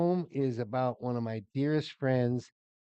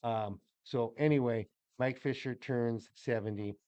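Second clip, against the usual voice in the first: ordinary delivery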